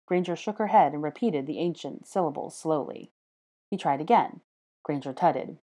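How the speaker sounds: ordinary delivery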